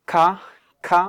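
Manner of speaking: "খা" with a strong puff of air after k; aspirated sound. Shown as a demonstration. Teacher demonstrating the aspirated sound, "খা" said on its own. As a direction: isolate "খা", each one separately aspirated. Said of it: "এটা একটা aspirated sound".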